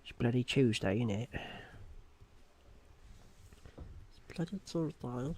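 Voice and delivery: put-on British accent; exaggerated British slang voice. Puts on a British character voice.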